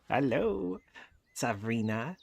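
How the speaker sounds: excited hello. Real, not acted. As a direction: greet them like adorable babies. Greeting the animal by name.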